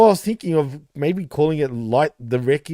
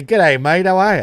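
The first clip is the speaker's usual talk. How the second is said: louder put-on Ocker Australian greeting; broad Aussie; 3 semitones higher, 4 dB louder than usual. Co-host offering a mock show greeting, broad Ocker Aussie. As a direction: lay on the Aussie accent thick. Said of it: "I can do Aussie if you really want me to".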